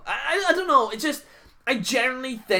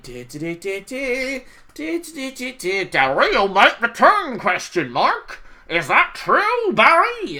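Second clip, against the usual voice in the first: clickbait parody; 3 semitones higher, 3 dB louder than usual. Retold or imitated.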